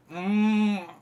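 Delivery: high, loud drawn hum; child's "mm". Acted. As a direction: play a little girl's mm with tongue out. Halfling girl sticks out tongue.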